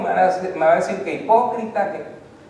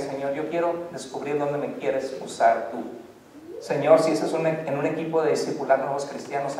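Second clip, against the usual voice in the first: softer, lower, earnest offering; humble prayer; 3 semitones lower, 4 dB quieter than usual. Acted. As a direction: say it quietly, surrendering. Modelled commitment prayer.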